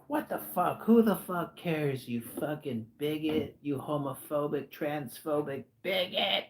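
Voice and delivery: slow, high-pitched outraged rant; angry rant. Retold or imitated. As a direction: drag it out as an outraged guy. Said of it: "He's like".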